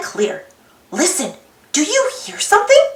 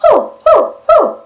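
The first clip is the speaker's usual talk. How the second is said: higher, louder hooting owl calls; playful owl hoo hoo; owl hoots; 5 semitones higher, 4 dB louder than usual. Pretend. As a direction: hoot like an owl to the kids. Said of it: "and owls saying".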